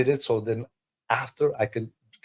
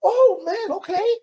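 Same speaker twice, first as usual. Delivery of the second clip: much higher, breaking with surprised emotion; overwhelmed, voice cracking high; stunned disbelief. Retold or imitated. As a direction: gasp it out, almost crying in disbelief. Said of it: "I was like".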